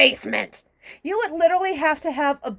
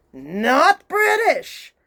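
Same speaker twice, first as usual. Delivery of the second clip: "not British" very slow, higher and punched; emphatic correction; 4 semitones higher, 4 dB louder, much slower than usual. Real, not acted. Louisiana native correcting Hollywood's accent choice, emphatic "not British". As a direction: stretch out NOT and BRITISH in exasperation.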